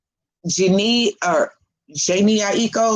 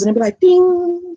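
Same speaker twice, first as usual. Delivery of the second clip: high, louder ringing "ding" like a bowl; singing bowl ding; 11 semitones higher, 5 dB louder than usual. Retold or imitated. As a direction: ring out "ding" like a struck bowl.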